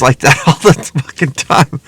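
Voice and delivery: real laugh breaking into the words; helpless chuckle through a complaint. Real, not acted. Speaker griping about normal jobs, laughing while talking. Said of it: "I don't know why I'm laughing".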